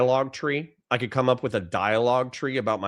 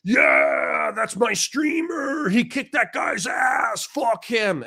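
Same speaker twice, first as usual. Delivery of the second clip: high, slow cheering yell; hyped fan shout; 12 semitones higher, much slower than usual. Retold or imitated. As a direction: cheer like a rowdy superfan.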